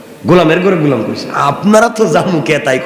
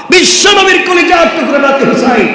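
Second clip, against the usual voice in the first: impassioned preaching; 11 semitones higher than usual. Acted. Preacher telling the story of Husain to the crowd, impassioned. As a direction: sweep the crowd along in full voice.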